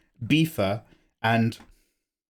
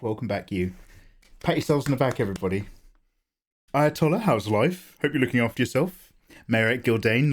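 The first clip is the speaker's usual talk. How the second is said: usual speaking voice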